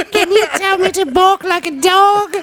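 very high, slow falsetto plea; besotted admirer. Acted. Imagined fan begging the foreigner, mock swooning. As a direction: beg in a hammed-up swooning voice.

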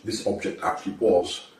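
normal talking voice

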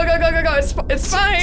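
frantic urging. Acted. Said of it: "I'm just like".

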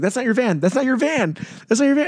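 high, insistent, rising in pitch; urgent retold warning. Warning a drunk friend off the wrong van, mock urgency. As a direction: re-enact frantically calling a friend back.

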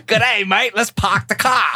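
joking Australian impression; Australian accent. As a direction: put on a cartoon Aussie voice. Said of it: "Australia, man".

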